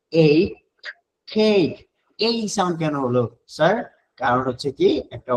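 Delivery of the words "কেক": কেক said with a long drawn e sound; long vowel demo. Shown as a demonstration. Teacher modelling the long vowel for students. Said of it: "উচ্চারণ হবে কি".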